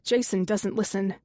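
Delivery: ordinary delivery, nothing marked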